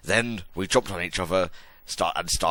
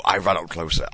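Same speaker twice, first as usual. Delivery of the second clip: plain reading voice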